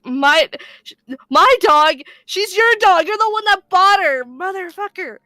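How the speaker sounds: high, loud mock outrage; indignant retort. Teller argues with dad over dog ownership, indignant mock shouting. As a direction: throw the blame back, loud and high.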